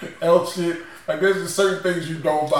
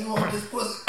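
crackhead woman voice; 6 semitones higher, 6 dB quieter than usual. Retold or imitated. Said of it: "hit you with that cough".

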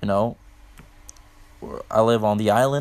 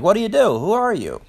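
higher, exaggerated prying questions; higher mock voice of the pushy stranger quizzing him; mocking the guy; 9 semitones higher than usual. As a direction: parody his nosy small talk. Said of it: "Like, to me".

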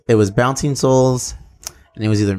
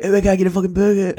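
quick, high mock Irish-like accent; uncertain accent imitation; 7 semitones higher, faster than usual. Redoing the band singer's burger question for laughs, mock Irish-like accent. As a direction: rattle it off in a hammed-up, vaguely Irish accent. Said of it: "like an Irish accent".

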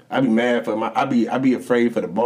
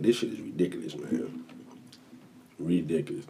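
fed-up mutter; 5 semitones lower, 11 dB quieter than usual. Retold or imitated. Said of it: "I be like".

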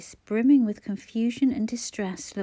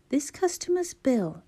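slow, higher, each word pointedly spelled out; slow sarcastic emphasis; 6 semitones higher than usual. A narrator's reading. Clara sarcastically spells it out to the checkout girl, slow and higher. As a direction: talk down to her as if she were slow. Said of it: "she said, slowly, with sarcastic emphasis".

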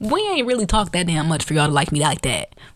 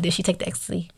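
softer straight-faced repeat of the question; deadpan; 5 dB quieter, faster than usual. Retold or imitated. Storyteller re-enacting the boyfriend's question to his girlfriend, deadpan. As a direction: imitate him asking quietly with no expression. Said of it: "Like, straight ass face".

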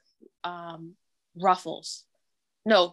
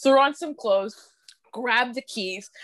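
ordinary delivery

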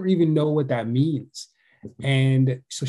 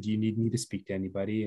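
soft tender offer; 4 semitones lower, 9 dB quieter, faster than usual. Retold or imitated. Father softly offering help.

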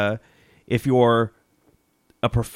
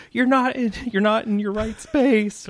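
mock yelling; 12 semitones higher than usual. Sister to brother running out the door, mock yelling. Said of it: "she's yelling at him".

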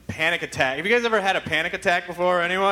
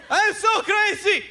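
much higher, slow manic pitchman; crazy pitchman; 11 semitones higher, slower than usual. Retold or imitated. Host mimics Crazy Gideon's ads, high slow manic pitch. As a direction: ham up a loopy salesman. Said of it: "tell you how crazy he is".

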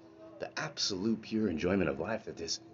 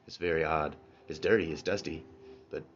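ordinary talk

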